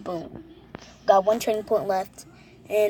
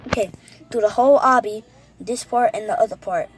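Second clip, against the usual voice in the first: excited; 8 dB louder than usual. Real, not acted. Excited instructions to self.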